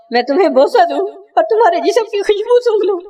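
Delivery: put-on high, pleading old mother's voice; frail old mother. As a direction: voice the blind mother reaching for her son, rising high. نابینا ماں بیٹے کو پکارتی ہے. (ماں کی اونچی، التجا بھری آواز)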